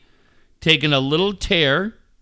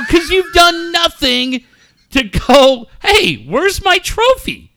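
high, drawn-out exasperated scolding; worked-up dad retelling his rant; retold yelling; 11 semitones higher, 4 dB louder, slower than usual. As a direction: scold him in a raised pitch, stretching the words. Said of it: "Why are you yelling at me".

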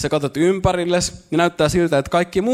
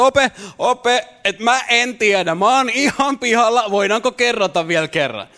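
lost pupil; 6 semitones higher than usual. Retold or imitated. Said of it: "sun tekisi mieli nostaa käsi ylös".

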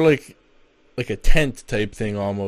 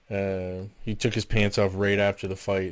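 ordinary delivery

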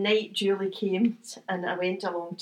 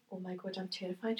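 hushed fear; 11 dB quieter than usual. Retold or imitated. Recalling fear before first show.

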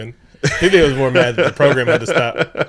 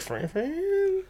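mock incredulous; 11 dB quieter than usual. [name] doubting the word friend.

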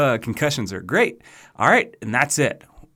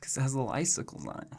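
quick hushed whisper; 10 dB quieter, faster than usual. Acted. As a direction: rush it out in a whisper. Explaining joke rating.

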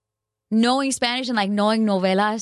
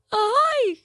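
a very high, strained yelp, self-consciously bad; squeaky failed holler; badly done call; 3 dB louder than usual. Shown as a demonstration. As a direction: try the festive shout and miss it. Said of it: "See, it's so bad".

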